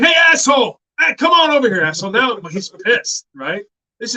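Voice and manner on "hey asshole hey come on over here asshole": loud, high joking insult shout; shouting playful insult at an old friend; retold yell. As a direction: bellow across the lot to get his attention. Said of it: "I'm like".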